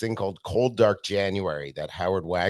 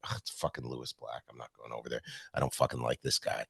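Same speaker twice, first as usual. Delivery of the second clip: quiet grumbling mutter under the breath, far softer; muttered aside; 7 semitones lower, 11 dB quieter, faster than usual. Retold or imitated. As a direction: grumble it to yourself so nobody hears. Muttered inner thought, retold.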